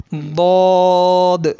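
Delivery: ضاد drawn out very slowly, thick and full in the mouth; heavy zuad. Shown as a demonstration. Tajweed teacher demonstrating the thick letter, slow and heavy. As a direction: stretch ضاد long and heavy. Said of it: "ضاد کو موٹا پڑھتے ہیں".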